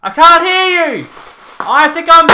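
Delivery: loud playful yell, high; mock shouting. Yelling over noise to the camera. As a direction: shout as if you can't hear. Yelling, pretending not to hear.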